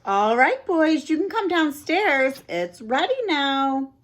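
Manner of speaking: put-on aunt calling boys down for dinner. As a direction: call the boys down. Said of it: "Aunt Nora called".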